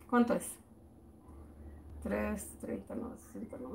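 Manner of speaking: very soft, trailing murmur to yourself; barely voiced mental arithmetic; counting under her breath. Real, not acted. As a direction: work out the sum almost inaudibly. Working out a sum to herself.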